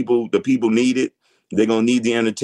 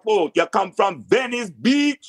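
slow Jamaican patois; Jamaican accent; 10 semitones higher, slower than usual. Pretend. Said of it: "Let me hear your Jamaican accent".

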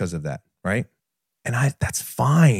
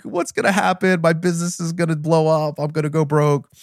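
high, anxious rush of worries; imitating his own frantic fear; panicked worrying; 8 semitones higher than usual. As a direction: spiral into mock panic about going broke. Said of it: "I was freaking out".

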